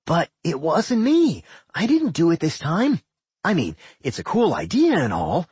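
high, whiny complaint; a petulant little dungeon; childish dungeon voice. A narrator's reading. Doc voiced, defensive.

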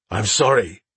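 weak hoarse croak. A narrator's reading. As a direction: croak it out, beaten and broken. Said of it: "Halion croaked".

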